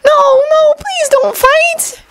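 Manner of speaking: very high, slow, whimpering plea; on the verge of tears. A narrator's reading. Shinobu pleads with the boys, nearly in tears. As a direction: beg as if about to cry. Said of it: "sounded on the verge of tears".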